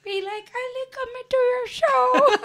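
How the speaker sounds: high, loud, shaky leprechaun. Pretend. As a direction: laugh through a shaky leprechaun. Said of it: "so shaky?".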